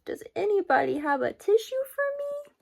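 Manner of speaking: high, small pleading voice; pleading flea voice. A narrator's reading. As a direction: beg for a tissue, high and small. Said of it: "his garbled plea".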